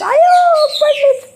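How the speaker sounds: loud, high-pitched yelp; startled high exclamation; alarmed cry. Real, not acted. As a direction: cry out at a sudden tumble. Child falls while being seated.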